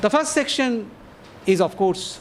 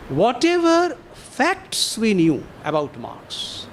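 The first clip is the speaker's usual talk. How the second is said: "facts" stretched out and set apart, slowly; stress on facts; 2 semitones higher, slower than usual. Real, not acted. Lecturer underlining a key term for the audience, weighty stress on "facts". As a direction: lean slowly and firmly on "facts". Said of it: "I emphasize the word facts".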